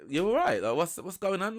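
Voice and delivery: high-pitched imitation of a puzzled man; retold puzzled question. Retold man questions her cold reception.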